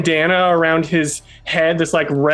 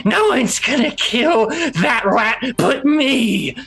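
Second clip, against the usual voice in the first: higher, slow menacing goblin voice; tough goblin growl; 7 semitones higher than usual. Acted. Ripper warns everyone off the rat, slow and menacing. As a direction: claim the kill, full of menace. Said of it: "he's like".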